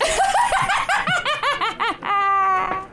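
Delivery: sustained high-pitched wicked cackle; crone cackle. Acted. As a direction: burst into a long, high cackle. Crone cackles on being told she's laughing.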